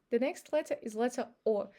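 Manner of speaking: ordinary talk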